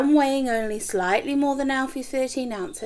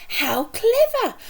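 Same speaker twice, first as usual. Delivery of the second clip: slow, high delighted gasp; much higher and slow with wonder; drawn-out delighted praise; amazed; 12 semitones higher, 3 dB louder, slower than usual. Acted. Missus Silver impressed.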